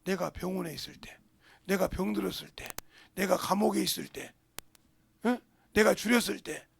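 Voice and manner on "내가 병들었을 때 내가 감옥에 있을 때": ordinary delivery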